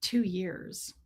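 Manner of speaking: low, quiet dry jab; mom's quiet put-down; deadpan judgy mom. Retold or imitated. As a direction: drop it flat and low. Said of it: "And she goes".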